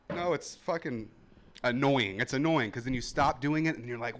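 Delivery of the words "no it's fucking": ordinary delivery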